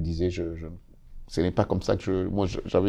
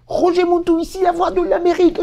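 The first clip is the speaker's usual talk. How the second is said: much higher, loud announcer shout; radio shout; 8 dB louder than usual. Retold or imitated. Rookie host shouting his intro into the microphone, too loud. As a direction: yell your radio intro into the mic. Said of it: "Je commence à crier".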